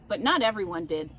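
usual speaking voice

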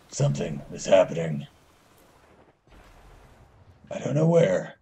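lightly voiced low superhero growl attempt; Batman. Gamer sensing trouble ahead, attempted low Batman voice. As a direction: drop it low, a hint of brooding hero. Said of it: "I can't do my Batman voice".

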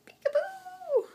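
very high slow sing-song peekaboo; drawn-out high peekaboo. Real, not acted. Playing peekaboo with toddler.

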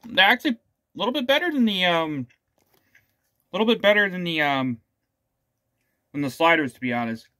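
talking between bites; muffled eating speech; mouth partly full, chewing. Real, not acted. Seen 0: Bit snack at -9s; lips close between phrases; holds food again.

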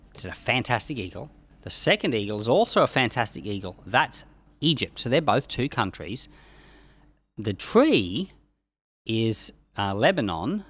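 usual speaking voice

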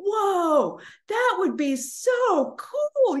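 high, slow, delighted whoa; delighted wonder. Retold or imitated. Woman feeling the joy of abundance, high and slow. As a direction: savor the dream with delight. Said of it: "she started laughing".

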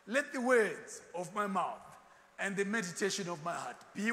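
slow, loud, drawn-out scripture reading; preaching scripture. Acted. Preacher reading Psalm aloud to his congregation, slow and loud. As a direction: proclaim the verse slowly to the congregation. Reads scripture in pulpit style.

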